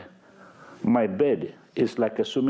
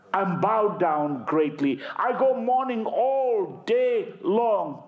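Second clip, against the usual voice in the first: anguished psalm reading; 9 semitones higher than usual. Acted. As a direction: wail the verse as lament. Preacher reads psalm aloud.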